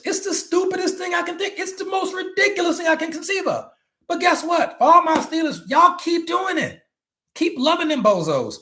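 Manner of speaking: teasing Steelers fans with a high, shouted mock rant; mock outrage.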